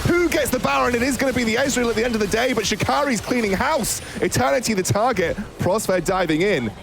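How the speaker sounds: high, fast hype casting; esports caster. Acted. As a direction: shout the teamfight like a caster. Hype call of teamfight.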